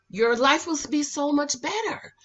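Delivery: normal talking voice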